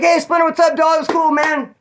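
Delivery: high, loud, eager hailing; mock friendly greeting. Inmates hailing the new fight winner, mock-excited. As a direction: put on an over-eager buddy greeting. Imitates yard crowd greeting him.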